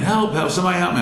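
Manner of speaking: high-pitched cry for help, held back in volume. Retold or imitated. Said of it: "I get louder and louder".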